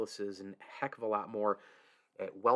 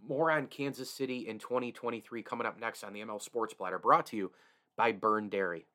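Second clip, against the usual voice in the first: ad read. Acted. Podcast ad read.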